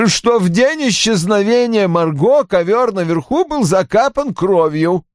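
high, emphatic cut-in; insistent interruption; interrupting. A narrator's reading. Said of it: "перебил его Рой".

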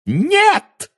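very high shriek. Acted. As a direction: shriek a desperate no. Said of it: "взвизгнула она".

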